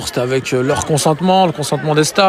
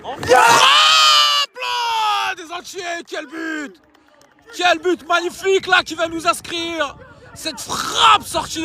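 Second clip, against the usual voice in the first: screaming goal call, high and drawn out; excited sports commentary; 13 semitones higher, slower than usual. Acted. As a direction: roar the goal like a stadium commentator. Goal celebrated live. (commentaire sportif survolté)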